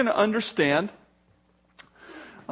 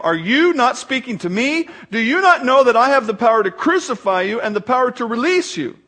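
raised, emphatic questioning, read aloud; insistent challenge; 6 semitones higher, 5 dB louder than usual. A narrator's reading. Pilate warns silent Jesus of his power, insistent challenge. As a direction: demand an answer from someone silent.

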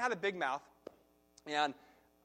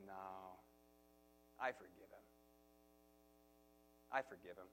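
calm forgiveness; 8 semitones lower, 14 dB quieter than usual. Retold or imitated. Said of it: "and he says".

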